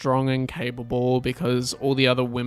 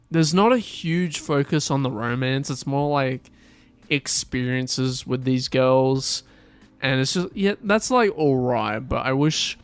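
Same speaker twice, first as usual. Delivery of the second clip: normal talking voice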